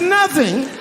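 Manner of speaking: very high, incredulous squeal; mock shock. Husband told the box was empty, high mock shock. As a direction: play aghast in a high voice.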